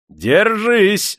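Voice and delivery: high, loud yell; shouted warning. A narrator's reading. Master threatens the old man standing still, shouting. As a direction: shout to make someone brace. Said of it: "закричал хозяин".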